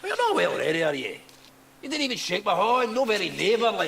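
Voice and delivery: high, loud jeering sneer; mocking taunt. Hyde taunting Utterson.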